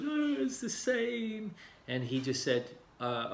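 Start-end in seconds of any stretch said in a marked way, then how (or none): 0.0-1.5 high, slow, dismissive reply; woman client. Retold or imitated. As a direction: answer in a much higher voice, dragging it out. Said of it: "And she goes".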